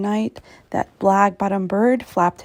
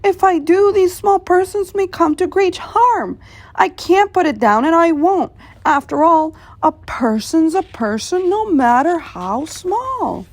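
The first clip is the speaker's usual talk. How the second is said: higher, firm elephant voice, standing his ground; determined elephant; 7 semitones higher, 4 dB louder than usual. Acted. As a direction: Horton refusing to give up, said with resolve. Horton's voice in the story.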